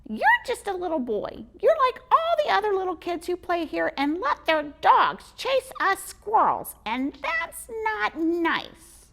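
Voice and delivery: high, loud scolding squirrel; squeaky squirrel voice. Acted. Squirrel scolding the boy about dogs, squeaky.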